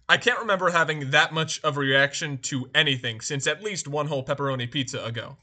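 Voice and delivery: ordinary talk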